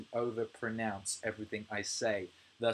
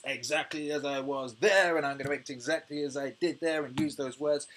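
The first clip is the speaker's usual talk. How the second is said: mock stubborn Brit; 3 semitones higher, 4 dB louder than usual. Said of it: "just being like".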